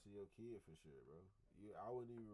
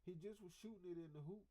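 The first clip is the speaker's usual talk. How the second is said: puzzled parent; 7 semitones higher than usual. Acted. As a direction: act out a parent suddenly noticing something is off. Acts out parent noticing the silence.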